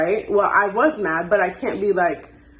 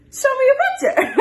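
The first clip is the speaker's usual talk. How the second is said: demanding proof; 4 dB louder, faster than usual. Retold or imitated.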